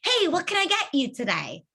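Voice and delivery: sing-song customer service voice; perky server asking for the order. Shown as a demonstration. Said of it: "you get that server voice".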